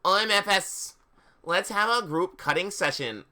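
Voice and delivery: lightly put-on professor voice; voiced story character. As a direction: read it up high and lively.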